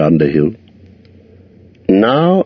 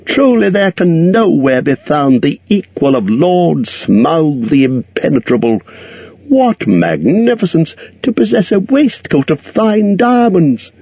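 butter up the dragon; flattering hobbit; 10 semitones higher than usual. A narrator's reading.

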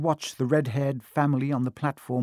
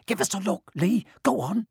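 put-on eager kid; 7 semitones higher than usual. A narrator's reading. Crowd urging boy with box.

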